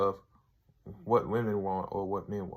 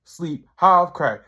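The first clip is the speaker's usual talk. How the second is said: louder, higher mock accusation; retold accusation; 5 semitones higher, 8 dB louder than usual. Rhonda accusing Tyrone at the intervention, louder retold telling-off. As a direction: call him out, building loud. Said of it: "she's like".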